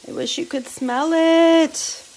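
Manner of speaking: high, drawn-out swoon over food; gushing. Real, not acted. Cook wishing viewers could smell it, gushing. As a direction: gush slowly, pitched right up.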